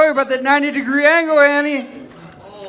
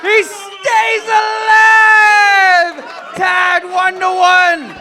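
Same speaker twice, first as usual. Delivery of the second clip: wrestling commentator; 6 semitones higher, 3 dB louder, slower than usual. Real, not acted. Live play-by-play climax.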